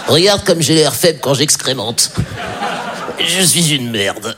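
slow, feeble whimpering dog voice; pathetic dog voice. Retold or imitated. Comic voicing his beagle trembling while pooping, slow and feeble. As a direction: play the dog as weak and ashamed. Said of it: "genre, regarde".

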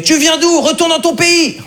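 high-pitched, hostile imitation; mock xenophobic heckle. Online commenter attacking a foreigner, mock hostile heckle.

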